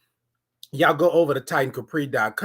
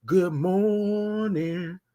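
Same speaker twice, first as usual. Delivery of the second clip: very slow stretched "Good morning"; drawn-out greeting; 4 dB quieter, much slower than usual. Shown as a demonstration. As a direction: stretch it slowly. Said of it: "come on in and".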